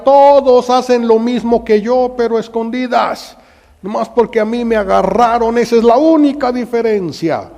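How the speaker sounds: very high, loud, resentful complaint; bitter shout. Acted. Gestas complaining he was only caught, shouting. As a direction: shout the unfairness.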